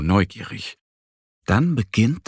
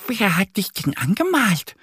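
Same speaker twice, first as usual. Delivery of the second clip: much higher, wondering question; curious; 12 semitones higher than usual. A narrator's reading. Annie curiously asking the zebra about its stripes. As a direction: ask full of curiosity. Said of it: "fragt Annie neugierig".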